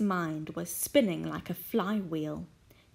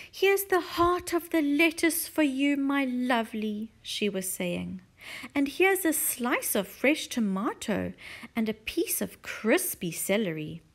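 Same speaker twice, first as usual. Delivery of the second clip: doting Mrs Silver; 4 semitones higher, 3 dB louder than usual. Acted. Mrs Silver feeding her tortoise.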